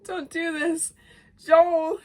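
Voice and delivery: high plea rising to a cry; imitated pleading; begging. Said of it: "he's begging now".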